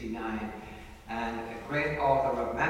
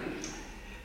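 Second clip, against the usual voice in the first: soft laugh; 8 dB quieter than usual. Real, not acted. Laughing after the remark.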